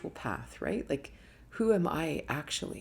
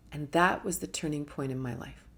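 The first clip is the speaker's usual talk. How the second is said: usual speaking voice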